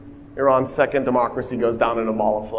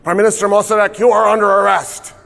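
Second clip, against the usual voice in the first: raised, formal arrest announcement; officer making an arrest; 6 semitones higher, 3 dB louder, faster than usual. Retold or imitated. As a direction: announce the arrest with stiff authority. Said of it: "He goes".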